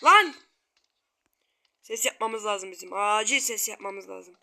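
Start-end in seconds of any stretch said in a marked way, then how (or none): 0.0-0.4 sudden higher yell; startled shout. Real, not acted. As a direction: jump and shout it.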